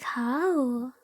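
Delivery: ordinary talk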